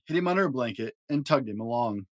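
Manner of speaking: ordinary delivery, nothing marked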